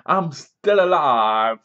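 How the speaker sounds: slow, loud, drawn-out hammed-up declaration; dramatic survivor. Lieutenant returning from the dead, slow loud hammy boast. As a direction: ham it up like a B-movie hero. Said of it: "he's like".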